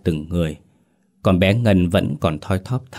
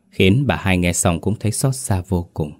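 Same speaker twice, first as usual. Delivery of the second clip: ordinary delivery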